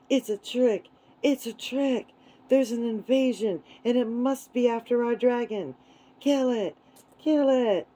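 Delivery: high, urgent rallying cries; mob yelling. A narrator's reading. Wild animals yelling about an invasion, urgent alarm. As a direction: yell it like an alarmed, angry mob. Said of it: "all yelling".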